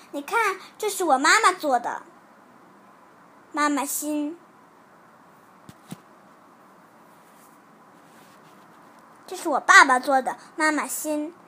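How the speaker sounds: put-on child mildly showing off; proud. A narrator's reading. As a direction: announce it with a touch of pride. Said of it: "他很得意地对小苹果说". (略带得意地炫耀)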